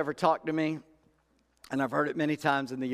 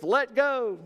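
the Lord answering; 10 semitones higher, 4 dB louder than usual. Retold or imitated. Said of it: "And the Lord says".

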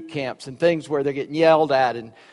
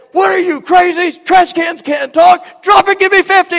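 drill sergeant yell; 11 dB louder than usual. Retold or imitated. Upperclassman punishing a cadet, loud bellowing order. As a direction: bark in disbelief, then order pushups.

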